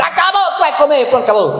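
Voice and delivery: mock a rough father snapping; brute father. Father scolding his child at lunch, mock harsh bark. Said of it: "você não vai ser bruto, ríspido, chucro".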